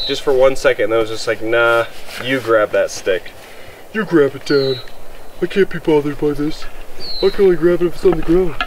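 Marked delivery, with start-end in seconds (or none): 1.4-8.5 a hint of a lazy, unbothered dog; lightly voiced dog. Dog refusing Dad's stick game, lightly voiced as unbothered. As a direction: lightly put on the dog's reluctant voice. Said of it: "he was like".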